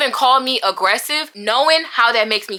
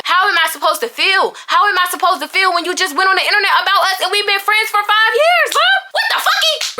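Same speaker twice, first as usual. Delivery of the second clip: rising outrage; 7 semitones higher than usual. Real, not acted. Venting at friend's internet post.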